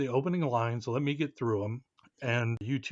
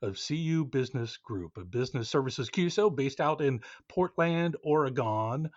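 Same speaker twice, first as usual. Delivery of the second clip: bright, clear announcer read; upbeat show opener; podcast host intro; 5 dB louder than usual. Acted. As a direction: welcome the listeners like a radio host.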